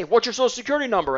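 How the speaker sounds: brisk, matter-of-fact question; just ask it straight, fast; confident, no-nonsense phone ask; direct ask. Shown as a demonstration. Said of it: "you know how I say it?".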